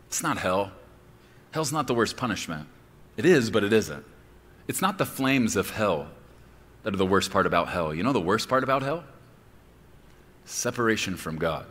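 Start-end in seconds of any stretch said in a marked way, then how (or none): none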